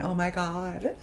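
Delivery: high, wailing mock sob; fake crying. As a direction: turn a laugh into a put-on cry. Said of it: "turned the laughing into crying".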